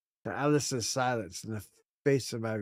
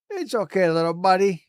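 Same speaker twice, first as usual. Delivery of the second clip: put-on high, soothing baby talk; comforting; 6 semitones higher, 7 dB louder than usual. Gamer consoles the dying Atlas, cooing and reassuring. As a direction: coo to it like a scared pet.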